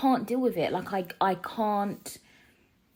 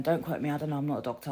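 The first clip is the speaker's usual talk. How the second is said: ordinary delivery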